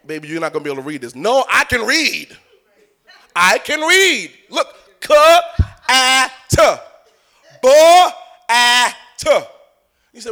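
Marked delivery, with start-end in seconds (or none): 0.1-1.2 mother's gentle warning. Acted. Mother answering her child. 1.2-9.4 put-on eager child. Child insisting to mother.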